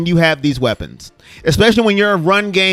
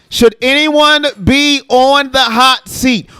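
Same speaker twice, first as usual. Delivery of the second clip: show host announcement; 5 semitones higher, much slower than usual. Acted. Repeating the topic question.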